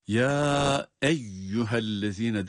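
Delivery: "Ya" held long in slow "Ya eyyühellezine"; long madd. Shown as a demonstration. Teacher repeating the verse opening, "Ya" drawn out. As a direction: stretch "Ya" before "eyyühellezine". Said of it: "burada daha çok uzatıyoruz".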